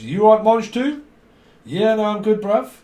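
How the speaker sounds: high, loud mock geezer exchange; hearty acted greeting. Asking Nige if he's all right, hammed-up and hearty. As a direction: hail a mate with hammed-up cheer.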